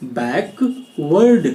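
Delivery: बैकवर्ड spoken very slowly, syllable by syllable; slow model word. Shown as a demonstration. Teacher repeating बैकवर्ड slowly for pupils. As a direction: draw out बैकवर्ड so pupils can copy it. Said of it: "जी हाँ, इसको पढ़ते हैं".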